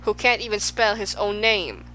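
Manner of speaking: ordinary delivery, nothing marked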